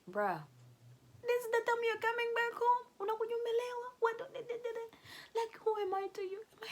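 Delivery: high, tearful, breaking voice; crying wife. Acted. Wife confronting drunk husband on phone, crying. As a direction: sob it out as the hurt wife.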